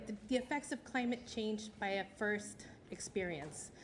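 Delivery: ordinary talk, nothing marked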